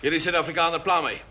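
ordinary talk

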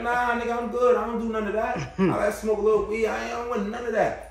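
lightly acted, high-pitched refusal; acted protesting refusal. Acting out refusing the offer.

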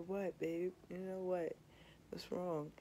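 softly imitating a just-woken boyfriend; retold waking partner. Boyfriend waking up to his partner's kisses, softly concerned. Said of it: "he wakes up and he said".

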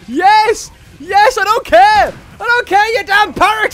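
loud, gloating shout at the parrot; triumphant yell. Real, not acted. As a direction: yell it out in victory. Gamer yelling at parrot enemy.

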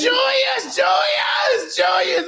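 high shouted fan chant of the name; yelling crowd. Retold or imitated. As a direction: yell the name over and over. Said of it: "they were yelling".

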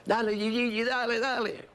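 the singer pushing her pianist mid-solo, high urging. Retold or imitated. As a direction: egg the pianist on like a rider spurring a horse. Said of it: "me dice".

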